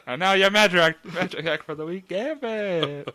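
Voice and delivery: loud, high stage announcer intro; showman announcer. Pretend. Host introduces Gambit's magic act as a loud, high showman. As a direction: present the act like a ringmaster.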